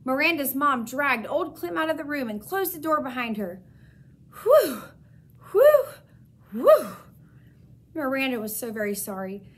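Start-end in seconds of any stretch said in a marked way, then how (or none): 4.4-6.9 loud high gasps of relief; relieved whew. A narrator's reading. Hamster sighs with loud relief after the dog leaves. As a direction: blow out the fear in three breaths.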